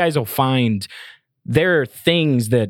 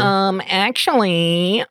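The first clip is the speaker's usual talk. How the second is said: drawn-out, higher know-it-all objection; mock pedant; 7 semitones higher, much slower than usual. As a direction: play a pedant butting in to correct. Playfully acts the correcting pedant.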